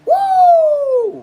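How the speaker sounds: very high, loud whoop; piercing playful whoop; falsetto woo. Pretend. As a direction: hoot a falsetto woo that slides down.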